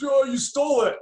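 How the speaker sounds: hammed-up grumbling accusation; imitating Charles Barkley. Retelling Charles Barkley's complaint.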